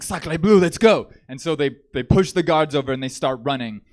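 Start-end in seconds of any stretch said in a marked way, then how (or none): 0.0-1.1 loud, raised cartoon Frenchman; French guy. French prisoner urging grandpa to flee, loud mock-French exclamation. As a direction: exclaim it as a hammed-up French escapee. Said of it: "and the French guy is like".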